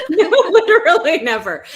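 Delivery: high, loud and laughing; laughing agreement. Real, not acted. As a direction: agree emphatically through real laughter. Agreeing while cracking up.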